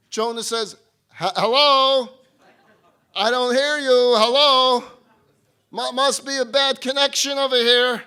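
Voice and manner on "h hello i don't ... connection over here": loud, hammed-up "hello?" into a bad line; mock bad phone line. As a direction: pretend you can't hear the caller. Jonah pretends the phone line is bad.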